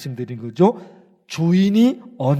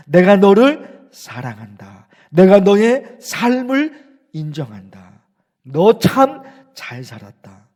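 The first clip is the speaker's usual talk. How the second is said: slow, louder, solemn declaration of love; God's loving voice; 7 dB louder, slower than usual. Acted. Preacher voicing God affirming a life well lived, slow and loud. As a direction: proclaim it slowly as God's embrace. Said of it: "이런 음성을 들어야 되죠".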